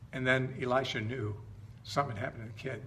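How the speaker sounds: usual speaking voice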